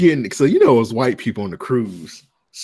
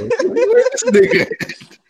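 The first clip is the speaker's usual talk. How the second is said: laughing disbelief; 4 dB louder, slower than usual. Retold or imitated.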